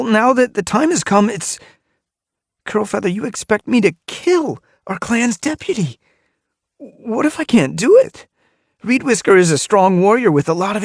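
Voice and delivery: high, strained panicky voice; rising panic, loud and high; panicked. Acted. Splashtail's panicked fear.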